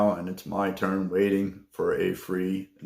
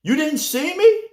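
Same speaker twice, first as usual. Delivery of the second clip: imitating an indignant receptionist, much higher; indignant lady; 3 dB louder than usual. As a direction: insist she was waving, in her voice. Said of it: "She says".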